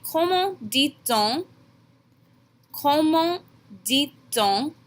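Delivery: Comment dit-on, ko-mahn dee-tohn, slow, rising; teacher modelling for students; slow French demo. Shown as a demonstration. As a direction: ask it slowly as a question. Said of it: "you're going to ask me".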